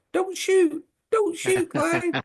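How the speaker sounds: very high, thin, pleading squeak; a tiny voice begging him not to shoot; weedy little voice. Retold or imitated. A teammate pleading from behind as Klein goes through, weedy squeak. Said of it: "a weedy little voice in the background going".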